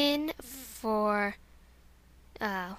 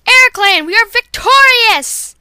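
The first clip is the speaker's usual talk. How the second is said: loud, high victory cry, slowed on victorious; rally the clan after a win; a triumphant warrior cat shouting victory; 8 semitones higher, 14 dB louder, slower than usual. Acted.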